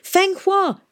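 high, urgent shout of the name. A narrator's reading. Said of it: "Tom shouted".

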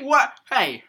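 high loud indignant what; indignant outcry. Real, not acted. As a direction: cry out, offended. Indignant at ignored pun.

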